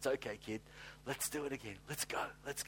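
soft, gentle nudge from a father; God to his son, quietly reassuring; fatherly encouragement. Retold or imitated. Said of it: "he says".

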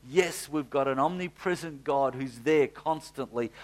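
ordinary delivery

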